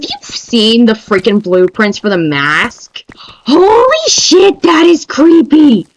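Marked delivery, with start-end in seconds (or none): 3.2-5.9 high, slow "holy shit"; freaked-out exclamation; shocked. Real, not acted. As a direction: fan reacting to creepy blueprints.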